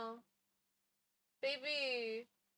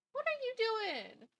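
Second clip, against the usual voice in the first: much higher sing-song voice for a pet; pet talk; 10 semitones higher than usual. Real, not acted. Streamer turns to her cat, high pet talk. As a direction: coo it at a pet.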